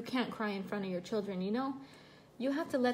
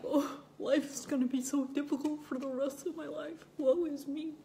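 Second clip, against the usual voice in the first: higher-pitched, hammed-up woe-is-me wail; mock self-pity; 5 semitones higher than usual. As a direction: mimic someone overdramatically despairing. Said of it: "I'm not freaking out like".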